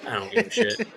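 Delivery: high, put-on dismissive mom; mother's dismissive voice. A hint of the mother brushing off her quitting child. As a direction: toss it off like a fed-up mother. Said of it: "She was like".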